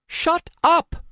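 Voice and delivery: high, loud outburst; furious high-pitched yell; angry shout. A narrator's reading. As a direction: explode at them, high and loud. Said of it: "never before even raised her voice".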